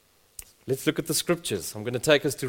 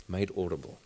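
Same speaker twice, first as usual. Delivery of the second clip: repeated much softer and lower, almost to himself; soft echo; 7 semitones lower, 10 dB quieter than usual. Shown as a demonstration. Preacher echoing the phrase to the congregation, hushed and low. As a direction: let it sink in, quietly.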